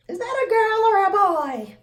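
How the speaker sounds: high put-on voice asking; mocking stranger. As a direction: squeak it like a puzzled stranger. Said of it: "and you're like".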